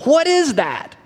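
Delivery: high-pitched, puzzled exclamation; baffled self-questioning. Acted. Man puzzling over what comes up inside him, high and baffled. Acted puzzlement at own feelings.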